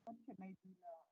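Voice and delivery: faint voice fading out on the line; words almost lost, very faint; barely audible. Real, not acted. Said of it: "hear you at all".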